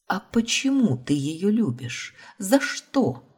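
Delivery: high-pitched, pressing questions; won't let it drop; insistent. A narrator's reading. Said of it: "Не унималась".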